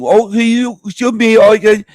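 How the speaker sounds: high-pitched, badly faked Indian accent; failed Indian accent. Call center worker in India answering, botched Indian accent. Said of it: "I can't do an Indian accent".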